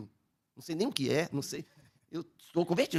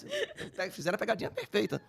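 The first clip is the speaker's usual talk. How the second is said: laughing; 6 semitones higher than usual. Real, not acted. Laughs recalling the prank.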